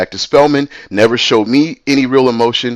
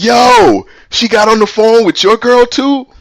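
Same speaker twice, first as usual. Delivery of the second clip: high, louder Yo, then an incredulous question; retold astonishment, pitched way up; amazed disbelief; 12 semitones higher, 4 dB louder than usual. Friend hearing that Stacy did it to him too, high mock amazement.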